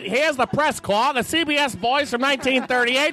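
put-on old-time radio announcer. Reporter hyping the winner for listeners, brisk old-time radio patter. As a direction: gush like a 1938 CBS newsman. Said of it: "they talk like this the whole goddamn time".

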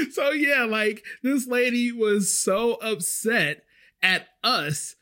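high-pitched, laughing delivery, easing off; talking through laughter. Real, not acted. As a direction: still cracking up while summing up. Laughing at her own story.

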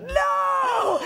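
high, shocked no. Retold or imitated. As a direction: recoil in disbelief. Mike's shocked reply.

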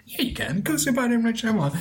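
a dad urging his kid on, retold; imitating dad. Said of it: "Dad, like, I can't say".

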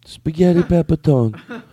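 slow, drawn-out mock Italian; mock Italian accent. Echoing his co-host's food name in mock Italian. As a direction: savor the name like a hammed-up Italian.

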